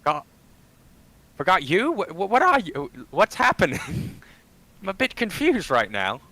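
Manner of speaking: high, loud, stammering; panicked confusion. Pretend. Player character bewildered and frightened.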